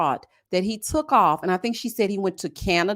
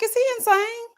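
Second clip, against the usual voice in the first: incredulous; 12 semitones higher than usual. Retold or imitated. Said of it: "I was like".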